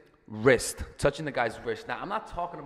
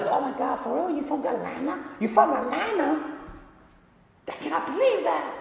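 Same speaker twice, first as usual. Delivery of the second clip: much higher, bubbly amazed squeal with a laugh; gushing girl on a date; 5 dB louder than usual. Pretend. Woman on a date gushing at a guy, high giddy squeal. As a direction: play the wide-eyed girl thrilled he is from Atlanta. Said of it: "you could be like".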